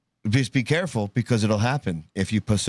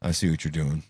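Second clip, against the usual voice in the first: lower, knowing shop-owner line; quoting a shop owner; 6 semitones lower than usual. Retold or imitated. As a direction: lightly voice the suspicious shop owner. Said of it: "the owner of Bricks and Minifigs when he says".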